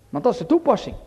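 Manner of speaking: usual speaking voice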